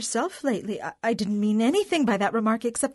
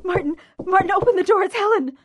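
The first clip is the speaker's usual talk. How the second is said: high, loud urgent call through a door; alarmed calling; 6 semitones higher, 6 dB louder, faster than usual. Acted. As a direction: call out anxiously to be let in. Sister calls through the door.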